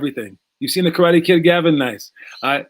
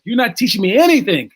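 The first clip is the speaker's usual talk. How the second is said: higher, louder frustrated outburst, retold; mad student; 6 semitones higher, 4 dB louder than usual. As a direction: let the student vent at his teacher. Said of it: "got mad at the guy and goes".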